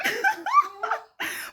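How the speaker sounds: high giggling laugh; naughty high laughter at one's own joke. Real, not acted. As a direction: burst into a high-pitched giggle.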